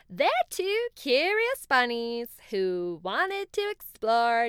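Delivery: fond and proud of his bunny friends; unicorn voice. Acted. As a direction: unicorn introducing his friends to the owl, slow and loud. Unicorn introduces bunnies to owl.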